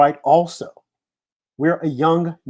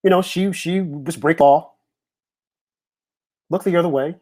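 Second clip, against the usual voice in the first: higher, mock-dismissive shrug of a voice; mock shrug, pitched up; mocking dismissal; 4 semitones higher than usual. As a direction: sneer out the excuse, pitched up. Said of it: "people are saying".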